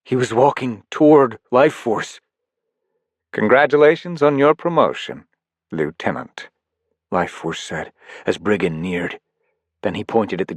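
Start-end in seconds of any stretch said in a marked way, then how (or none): 3.3-6.5 smug villain. Acted. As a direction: sneer the congratulations. Villain Life Force addresses Briggon.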